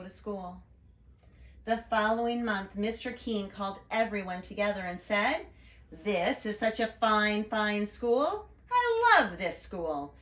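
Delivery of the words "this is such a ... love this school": slow, grand announcement; proud principal. Acted. Principal announcing Saturday school to everyone, slow and proud. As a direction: savour each word of the big announcement.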